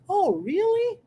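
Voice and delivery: Oh, really? rising high in surprise; surprised really. Shown as a demonstration. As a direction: react with surprise. Surprised reply in dialogue.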